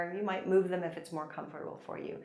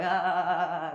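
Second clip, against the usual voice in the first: a loud open "ah" while shaking out; the teacher demonstrates a stress-releasing "ah" to the class; loud release sigh; 6 dB louder than usual. Shown as a demonstration. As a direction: let your voice go on a loud ah. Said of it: "Shaking and going".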